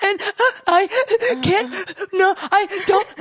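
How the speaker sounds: high, gasping, broken-up mock sobs; imitating hysterical crying. Retelling her crying spell to friend, hysterical gasping imitation. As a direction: act out choking on words, unable to get them out. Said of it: "I was, like, hysterical".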